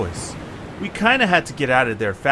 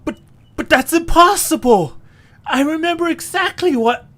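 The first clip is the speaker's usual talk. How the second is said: much higher, stammering in shock; the rules have changed and he can't believe it; startled stammer; stunned disbelief; 11 semitones higher, 3 dB louder than usual. Acted.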